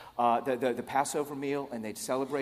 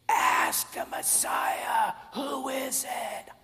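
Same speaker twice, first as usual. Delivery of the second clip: slow, strained stage whisper; whisper-shout; 12 semitones higher, much slower than usual. Acted. Peter secretly urging John across the table, whisper-shouted.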